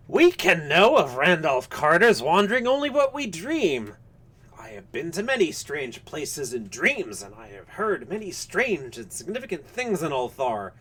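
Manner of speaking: put-on high, shrill old man's voice, swooping up; harsh, shrill old man. A narrator's reading. Old Phillips answers about Carter's dreams, harsh and shrill. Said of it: "spoke in a harsh, shrill voice".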